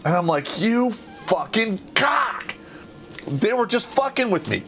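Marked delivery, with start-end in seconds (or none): 0.6-2.5 high, slow, drawn-out curse; retold indignant curse. Jumper cursing the man who kicked him, drawn-out indignation. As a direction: stretch each word in mock outrage.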